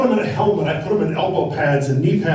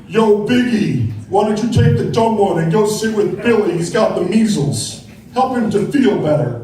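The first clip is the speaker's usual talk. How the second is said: eighties gang member; 4 dB louder than usual. Retold or imitated. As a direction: play mom like a gang member barking orders. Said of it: "my mom sounded like an eighties gang member".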